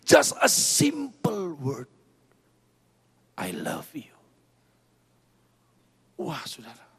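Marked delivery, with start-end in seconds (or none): 3.4-4.2 tender whisper. Acted. Tender whispered declaration of God.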